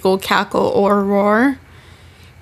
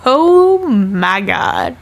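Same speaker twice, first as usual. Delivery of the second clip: slow awed oh my God; 3 semitones higher, much slower than usual. Real, not acted. Raving about binge watching.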